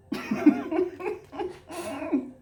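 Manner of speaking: bright high laugh. Real, not acted. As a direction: laugh at her own joke. Laugh after own joke.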